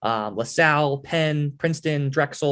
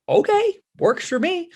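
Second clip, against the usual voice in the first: much higher, squeaky amazed okay; stunned delight; slower than usual. Retold or imitated. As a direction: act floored by the big offer, retold. Stunned by the offered fee.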